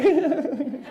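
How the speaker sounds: high, rippling giggle. Shown as a demonstration. Delighted at seeing clients as masters, high giggle. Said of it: "it's like a giggle".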